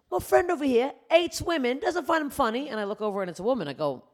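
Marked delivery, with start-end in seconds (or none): 0.1-2.7 loud, high put-on British accent; British guy. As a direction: imitate a British bloke calling out. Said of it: "there's this British guy who goes".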